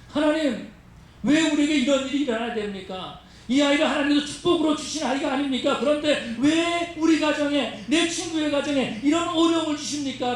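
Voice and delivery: high, anguished protest to God; complaining, resentful. Acted. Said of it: "불평하고 원망하고". (원망하며 높은 목소리로 따지듯)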